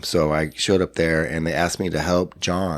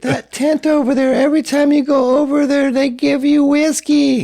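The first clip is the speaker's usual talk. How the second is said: put-on drunk girlfriend; 6 dB louder than usual. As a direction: squeal it like a giddy drunk girlfriend. Drunk girlfriend explains the whiskey.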